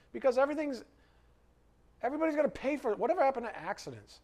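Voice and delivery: rising indignation at blame culture; getting angry. Real, not acted. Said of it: "I'm getting angry".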